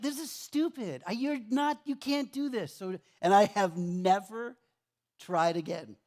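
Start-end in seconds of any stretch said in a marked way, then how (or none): 0.0-2.7 high, frustrated self-scolding; exasperated quitting rant; fed up. Retold or imitated. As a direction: scold yourself, pitched way up. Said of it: "I'm like".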